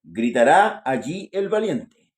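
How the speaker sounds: loud, slow, declaimed Bible verse; scripture reading. Acted. Reader proclaims the day of the Lord to church, solemn scripture reading. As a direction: proclaim the verse slowly and forcefully.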